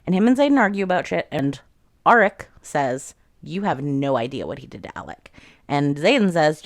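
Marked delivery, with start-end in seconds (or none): 3.4-5.3 low unvoiced whisper, bitter. Auric to Xaden about his brother, whispered. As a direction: hiss it under your breath, accusing.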